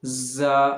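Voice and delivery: ज़ा with the tongue kept behind the teeth, buzzing. Shown as a demonstration. Teacher demonstrating the letter, tongue kept inside. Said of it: "ज़बान अंदर रहे और फिर आप बोलें".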